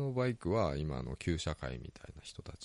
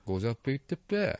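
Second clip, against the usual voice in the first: Ibaraki dialect imitation; 5 semitones higher, faster than usual. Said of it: "ていう使い方じゃない".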